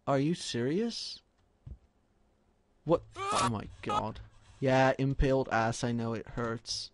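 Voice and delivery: ordinary talk, nothing marked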